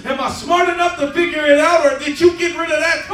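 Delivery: loud, high, anguished cry to the Lord; Job crying out. Acted. Preacher voicing Job crying to God, loud anguished plea. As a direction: voice Job's desperate plea, near shouting. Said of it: "He's crying. He's like".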